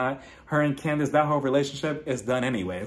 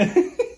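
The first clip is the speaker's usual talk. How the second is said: high, short laugh; real laugh; 3 dB louder than usual. Real, not acted. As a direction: giggle it out, high-pitched. Laughs after the wine remark.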